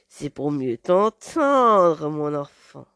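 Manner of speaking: very slow, low, drawn-out wolf; big bad wolf. Wolf answers the girl's question, slow and menacing. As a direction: stretch every word menacingly.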